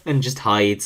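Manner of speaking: ordinary talk, nothing marked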